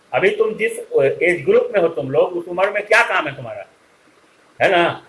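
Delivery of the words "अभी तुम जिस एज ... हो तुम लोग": normal talking voice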